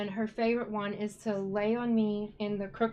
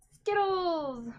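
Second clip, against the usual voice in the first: higher, louder call of the name; bright call to a pet; calling the cat; 8 semitones higher, 4 dB louder than usual. Real, not acted. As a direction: call the cat over from across the room.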